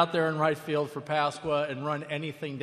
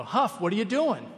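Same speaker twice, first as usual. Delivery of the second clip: calling out; 6 semitones higher, 6 dB louder than usual. Retold or imitated. Teammates call him from dugout.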